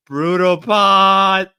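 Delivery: booming repeat. Pretend. Streamer repeating chat's phrase, booming and drawn out. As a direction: stretch both words into a big shout.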